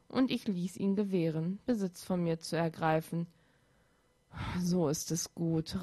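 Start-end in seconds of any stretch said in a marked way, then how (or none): 4.6-5.8 low, soft seductive murmur; luring spirit whisper. Acted. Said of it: "raunte die Stimme".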